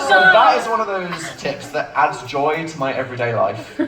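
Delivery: normal talking voice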